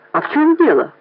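high-pitched, mildly annoyed question; somewhat irritated. A narrator's reading. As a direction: ask with a touch of irritation. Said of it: "несколько раздражённо".

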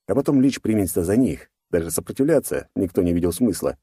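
plain reading voice

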